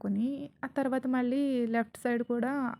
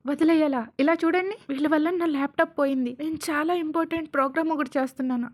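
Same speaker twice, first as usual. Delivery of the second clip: heated complaint, raised pitch and volume; loud, higher-pitched indignant rant; angry yelling; 4 semitones higher, 5 dB louder than usual. Acted. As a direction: argue back loudly, refusing to let it go. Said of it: "అని serious అవుతాడు అరుస్తూ".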